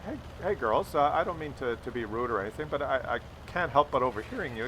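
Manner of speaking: put-on lightly mimed polite old man; very politely. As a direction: ease in gently and politely. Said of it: "very politely".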